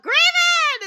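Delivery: loud high call across the room; excited shouted greeting; shouted name. Retold or imitated. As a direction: yell his name out happily. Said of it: "I'm just gonna shout".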